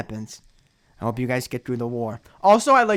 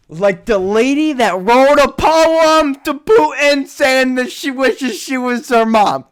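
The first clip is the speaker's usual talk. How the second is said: mocking tone; 9 semitones higher, 8 dB louder, slower than usual. Mocking Putin poem lady.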